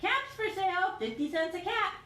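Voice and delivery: high sing-song street cry; peddler's call. Acted. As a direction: hawk the caps like a street peddler. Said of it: "calling out".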